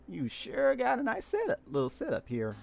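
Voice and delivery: high-pitched southern country twang; southern cowgirl accent. Acted. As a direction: sound like a southern cowboy girl.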